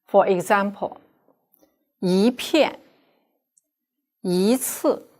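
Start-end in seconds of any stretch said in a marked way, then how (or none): 2.0-5.1 一 rising before a fourth tone in 一片 and 一次; careful model of 一片 and 一次 with a rising 一; teacher demonstrating the yi tone change; yi as second tone. Shown as a demonstration. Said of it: "yi will be pronounced as second".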